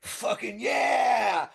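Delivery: high, drawn-out battle yell; fan imitating hero. Fan mimics the hero to his face, hammed-up yell. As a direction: stretch it into a slow, whooping shout. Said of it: "You were like".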